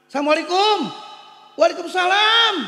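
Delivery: high, loud sing-song salam; loud greeting. Retold or imitated. Nephew and aunt greeting at her door, high and loud.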